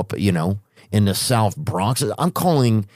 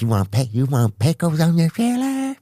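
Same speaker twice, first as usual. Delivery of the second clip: lightly voiced server, a bit higher; casual offer in a mock server voice; waiter's voice; 6 semitones higher than usual. Server offering pickles, lightly voiced.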